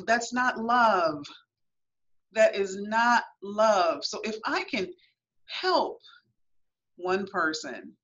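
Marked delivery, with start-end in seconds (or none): 0.0-4.0 slow, every word weighed, "love" pressed down; slow firm insistence. Acted. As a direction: say it slowly, as a warning to a young girl. Firm advice to young girls.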